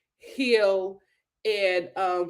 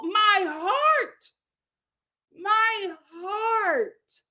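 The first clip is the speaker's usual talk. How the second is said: overcome gushing; 9 semitones higher, much slower than usual. Real, not acted. Host gushes over Julia's opening.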